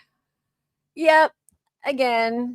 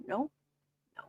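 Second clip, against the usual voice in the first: ordinary talk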